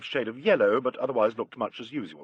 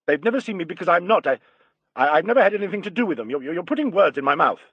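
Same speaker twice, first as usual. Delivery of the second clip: higher, hurried, stumbling protest; flustered denial; 5 semitones higher, 4 dB louder, faster than usual. A narrator's reading. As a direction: trip over the words, protesting in rising panic. Cornered suspect stammers out denial.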